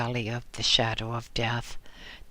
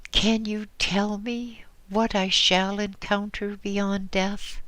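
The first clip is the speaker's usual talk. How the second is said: tremulous voice; 8 semitones higher than usual. Acted. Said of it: "she asked in a tremulous voice".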